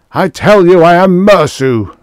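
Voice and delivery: high, full-force furious shout; screaming. A narrator's reading. Fire god insisting on who he is, screaming. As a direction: scream it to make them believe. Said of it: "Mersu screamed".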